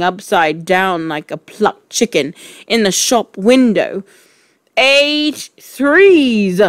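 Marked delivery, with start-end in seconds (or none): 4.8-6.6 high, loud, drawn-out shout; booming schoolmistress shout. A narrator's reading. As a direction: bellow it slowly at a pupil. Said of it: "shouted Trenchbull".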